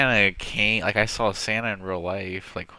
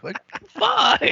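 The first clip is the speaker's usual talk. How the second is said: exasperated outburst; 3 dB louder than usual. Retold or imitated. Panicked reaction in a retold story.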